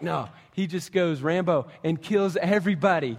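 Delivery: usual speaking voice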